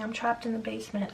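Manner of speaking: normal talking voice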